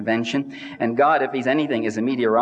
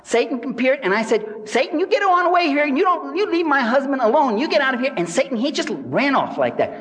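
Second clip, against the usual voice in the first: high, shrill-free but much higher mock wife shooing Satan away; mock preacher's wife; 10 semitones higher than usual. Wife on TV telling Satan to leave her husband alone, high mock voice. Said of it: "Then his wife chimes in. She says".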